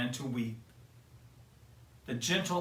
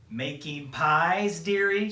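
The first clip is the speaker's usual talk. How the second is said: slow, higher, louder crone voice; old peddler woman; 4 semitones higher, 6 dB louder, slower than usual. Acted. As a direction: wheedle like a sly old hag. Disguised queen at window.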